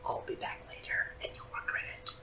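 breathy menacing whisper; whispered threat. Acted. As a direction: hiss the threat under your breath.

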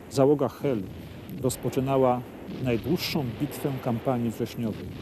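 low, grave history narration; documentary narration. A narrator's reading. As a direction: read it like a solemn wartime chronicle. Guide reads documentary narration.

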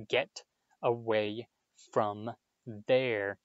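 slow, word-by-word command; measured reprimand, pausing between words; stern order. A narrator's reading. Percy ordering the boys out, sternly.

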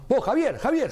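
high call across the room; high-pitched call to a colleague. Retold or imitated. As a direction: shout his name to get his attention. Calling out to Javier.

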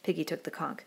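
usual speaking voice

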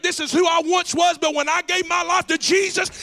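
high, loud sung preaching cadence; preaching at full force. Real, not acted. Pastor whooping to the church about Jesus as bait. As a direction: whoop it up, building to the climax.

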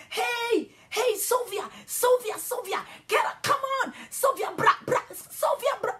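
put-on children screaming for their friend to come; calling urgently. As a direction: shout to pull her away. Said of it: "they are calling me".

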